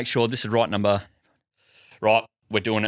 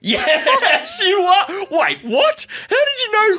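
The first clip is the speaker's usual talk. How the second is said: high, loud, laughing disbelief; shocked laughing; 5 dB louder, slower than usual. Real, not acted. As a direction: caught completely off guard, cracking up. Caller pre-empts the phrase.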